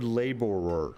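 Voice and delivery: struggled Michigan-accent "laborer". Shown as a demonstration. Streamer admitting he can't say the word, Michigan accent. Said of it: "God, I hate the Michigan accent".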